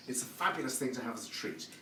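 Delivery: usual speaking voice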